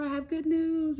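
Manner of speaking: high-pitched, excited announcement; gushing good news. Acted. Nurse bringing happy test results, gushing. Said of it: "just from her tone".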